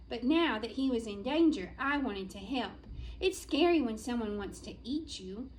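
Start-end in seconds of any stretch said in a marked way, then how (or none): none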